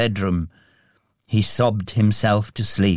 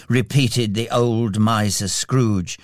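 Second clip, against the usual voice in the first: ordinary delivery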